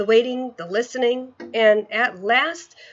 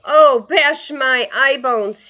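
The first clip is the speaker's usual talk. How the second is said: loud, slow, higher anguished cry; distressed exclamation; 5 semitones higher, 8 dB louder, much slower than usual. Acted. Giant waving the jar, crying out in distress. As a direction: bellow out his despair. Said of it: "getting more distressed".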